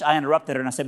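ordinary talk

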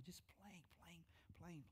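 very soft, fading away; hushed trailing. Real, not acted. Pastor picturing his wife at the piano, fading soft. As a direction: let it fall to a near hush.

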